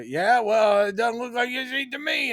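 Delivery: high, mocking trucker voice. Retelling the trucker taunting Clark, high and mocking. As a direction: sneer it like a smug bully.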